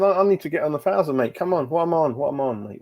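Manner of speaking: ordinary talk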